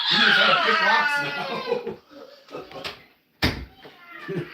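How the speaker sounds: big laugh, loud and slow through the words. Real, not acted. Toddler picked the lock, grandpa cracks up.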